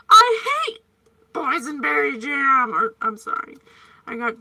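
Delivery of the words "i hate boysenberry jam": laughing yelled quote. Retold or imitated. Quoting Spaceballs to viewers, laughing loud yell. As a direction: shout it through laughter like the Spaceballs scene. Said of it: "they're like".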